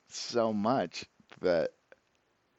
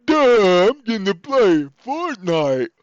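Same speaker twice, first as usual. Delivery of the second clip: loud, dopey mock voice, higher and slowed; dumb friend voice; 8 semitones higher, 9 dB louder, slower than usual. Friends shrugging off something important, mocked in a dumb voice. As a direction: play the friends as clueless airheads. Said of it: "And they're like".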